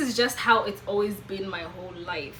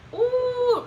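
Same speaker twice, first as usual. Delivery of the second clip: long, high-held "ooh"; knowing, high-pitched ooh; high drawn-out ooh; 13 semitones higher than usual. Real, not acted. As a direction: let out a high, sustained ooh. Aside on a bigger topic.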